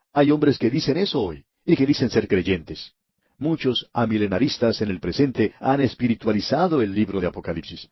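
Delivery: ordinary delivery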